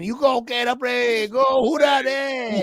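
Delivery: high, drawn-out hollering chant; hammed-up fan cheer. Cheering on the Saints pick, hammed-up fan chant. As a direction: holler it slowly like a rowdy supporter.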